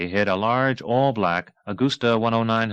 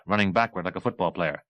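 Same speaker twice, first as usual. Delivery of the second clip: ordinary delivery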